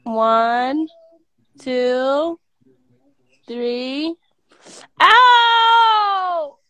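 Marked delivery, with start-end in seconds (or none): 5.0-6.6 pained cry. Real, not acted. Sudden pain, crying out.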